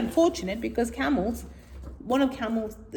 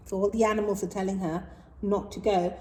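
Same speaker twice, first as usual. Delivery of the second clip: plain reading voice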